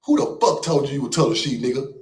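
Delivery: usual speaking voice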